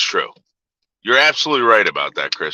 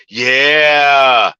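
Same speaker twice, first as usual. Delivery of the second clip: loud, long-held yeah; emphatic agreement; 5 dB louder than usual. Real, not acted. Streamer agreeing with a comment, loud and emphatic. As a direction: shout it out in one stretched word.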